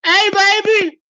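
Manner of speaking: high, put-on voice for Casper's question; lightly voiced goofy guy asking about ammo; mock dopey husband. Mimicking the husband asking his wife about ammo. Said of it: "Casper goes".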